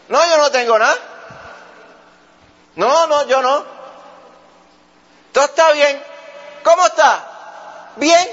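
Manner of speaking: high, loud, forced cheerful denial; a person hiding trouble who insists all is fine; insisting all's fine. Acted. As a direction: protest too much that nothing is wrong.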